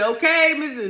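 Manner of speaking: high, loud call of the doctor; mock doctor call. Doctor calls out to the husband, high and loud.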